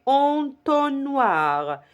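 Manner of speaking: ENTONNOIR stretched out, en-ton-noir; teacher modelling en-ton-noir for repetition; syllable by syllable. Shown as a demonstration. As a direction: draw out each syllable of entonnoir very slowly. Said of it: "Répétez".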